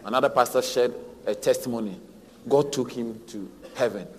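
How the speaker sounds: normal talking voice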